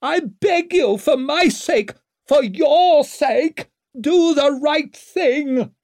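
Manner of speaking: sharp, very high, loud begging; shrieked plea. A narrator's reading. Mother begging the departing king for her son, shrieking. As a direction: shriek the plea, stretching the last words. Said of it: "shrieked after him, her voice so sharp".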